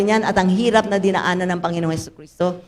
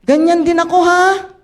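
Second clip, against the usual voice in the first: higher, louder, very slow mock protest; playful complaint to God; 7 semitones higher, 4 dB louder, slower than usual. Widow retelling her plea to the Lord, mock whine. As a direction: tease the Lord that it isn't fair. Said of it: "Sabi ko".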